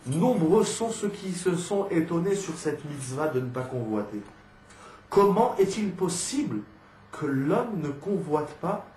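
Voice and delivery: slow, emphatic reading aloud; lecture solennelle. Acted. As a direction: read out the commentary slowly, weighing each phrase. Lit le commentaire d'Ibn Ezra. (lecture lente et déclamée)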